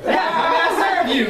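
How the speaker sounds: high, laughing mock-servile server voice; mock polite server. Mimicking a Chick-fil-A server to the audience, high laughing mock politeness.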